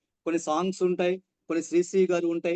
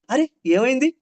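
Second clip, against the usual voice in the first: surprised; 7 semitones higher, 6 dB louder than usual. Shown as a demonstration. Emotion drills read aloud.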